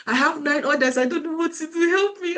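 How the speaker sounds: a mentee pretending to panic over nine orders; mock panicked plea. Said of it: "one of my mentee was crying".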